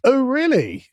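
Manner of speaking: high, rising-then-falling oh really; exaggerated sarcastic surprise; sarcastic "oh, really?" pitched high; mock surprise. Real, not acted. Surprised reaction in conversation.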